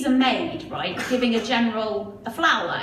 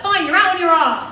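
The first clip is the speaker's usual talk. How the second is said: snippy; 5 semitones higher, 7 dB louder than usual. Retold or imitated. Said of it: "she's like".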